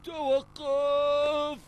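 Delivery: high, slow drawn-out shout; long yell. Acted. Long yelled stop.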